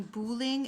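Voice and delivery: "bowling" said as boo-ling; French-style oo in "bowling": boo-ling; oo pronunciation. Shown as a demonstration. Explaining her kid's mix-up, "bowling" as boo-ling. Contrasts French and English word.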